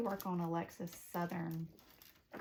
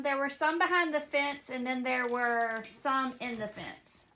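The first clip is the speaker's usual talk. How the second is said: loud, raised voice across the house; calling out; 5 semitones higher, 9 dB louder than usual. Real, not acted. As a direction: project an answer to someone far off. Answering aunt in another room.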